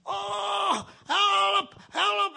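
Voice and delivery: high retold yell for help; screaming for help. Feverish boy to the house, mock scream for help. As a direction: cry out as if burning in hell. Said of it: "En ik schreeuwde het uit".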